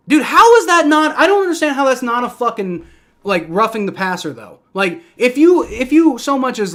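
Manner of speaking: high, loud and indignant; angry outburst. Real, not acted. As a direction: fan protests the missed roughing call, angry and loud. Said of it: "I'm absolutely fucking furious".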